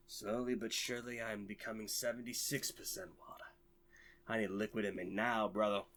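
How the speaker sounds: hammed-up dramatic narration about thirst; mock epic narrator. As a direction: play a dying desert wanderer for laughs.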